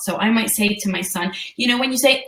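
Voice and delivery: ordinary talk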